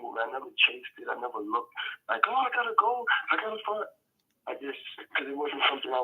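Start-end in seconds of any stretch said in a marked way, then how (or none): 2.3-3.8 high-pitched mock-frantic job chaser; mock desperation. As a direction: act out someone anxiously chasing work, pitched way up. Mimics frantic job chasing.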